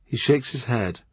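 plain reading voice

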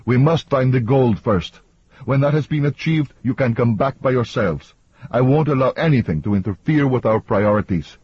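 high-pitched, insistent protest; yelled demand. A narrator's reading. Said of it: "Antonio yelled".

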